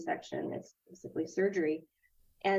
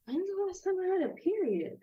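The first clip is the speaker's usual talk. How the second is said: sudden realization; 11 semitones higher than usual. Retold or imitated. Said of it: "where I was like".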